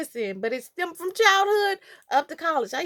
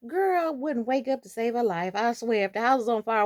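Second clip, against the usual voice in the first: ordinary delivery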